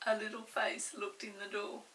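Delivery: ordinary delivery, nothing marked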